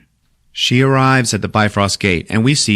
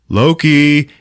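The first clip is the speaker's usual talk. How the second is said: calling out; 7 semitones higher, 5 dB louder than usual. Retold or imitated. Thor calls out to brother.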